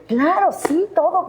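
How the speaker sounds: voz muy aguda y emocionada; emoción. Retold or imitated. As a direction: como soñando, feliz y eufórica. Emocionada ante la llamada. (emocionada y aguda)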